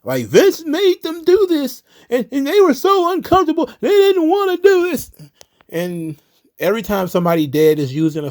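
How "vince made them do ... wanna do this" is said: very high, whiny mocking voice; whiny mock fan. Vlogger mocking fans defending the storyline, high whiny parody. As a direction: squeak it out like an outraged fan.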